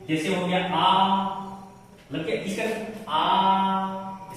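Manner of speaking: आ held slowly, sung out long. Shown as a demonstration. Teacher demonstrates the long आ to students. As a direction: draw out आ like a chant. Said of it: "जैसे मुझे यहाँ पर आप देखिए".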